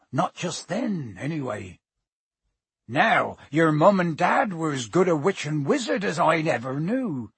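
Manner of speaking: rustic giant recounting dark days; earnest, uneasy character storytelling; Hagrid. Acted. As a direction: tell the boy the grim history. Voices Hagrid telling grim history.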